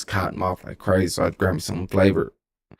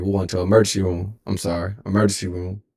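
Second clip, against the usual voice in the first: ordinary delivery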